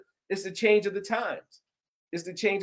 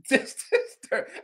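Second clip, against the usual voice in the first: slow, higher with a laugh on "disturbed"; laughing repeat; 5 semitones higher, slower than usual. Real, not acted. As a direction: chuckle through "Just disturbed".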